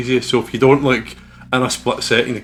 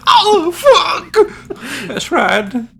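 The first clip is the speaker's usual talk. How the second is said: a high, loud cry of dismay; high shriek; 7 dB louder, much slower than usual. Real, not acted. Narrator crying out when the game turns bad, high loud wail. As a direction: wail it out as everything goes wrong.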